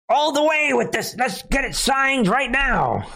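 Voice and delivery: high-pitched mock rallying shout; hammed-up eagerness. Mocking Saudis eager to sign the UN plan, over-the-top zeal.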